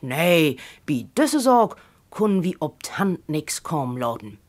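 ordinary delivery

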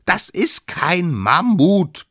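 high, loud, slow denial; stubborn little expert, every word stressed; insistent. A narrator's reading. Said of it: "beharrte der Allerkleinste". (beharrlich, hoch und laut)

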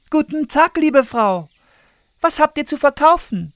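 put-on high, loud girlish call. A narrator's reading. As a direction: call down sweetly from the window. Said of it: "und rief".

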